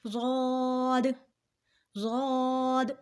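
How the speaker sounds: ضاد heavy, with long rounded "aw"; thick pronunciation. Shown as a demonstration. Teacher modelling the letter for learners, ضاد thick and drawn out. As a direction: round and stretch ضاد slowly. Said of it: "موٹا پڑھا جائے گا".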